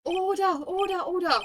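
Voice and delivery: squeaky judge calling order; high call to order. Acted. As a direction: raise the pitch and call order.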